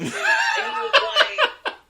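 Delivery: high, slow words broken by laughter; real laugh bubbling up, slow and high. Real, not acted. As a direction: laugh through the line as you start the story. Laughing while retelling.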